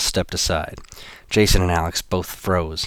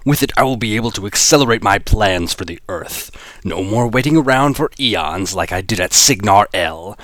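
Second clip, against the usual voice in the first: a hint of a gloating villain; lightly voiced menacing boss; 2 semitones higher, 3 dB louder than usual.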